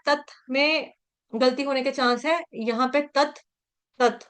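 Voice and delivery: normal talking voice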